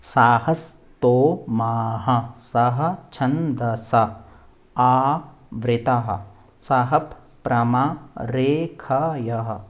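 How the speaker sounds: word-by-word repeat. Shown as a demonstration. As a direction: say it piece by piece for learners to copy. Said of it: "फिर से बोलेंगे इसको".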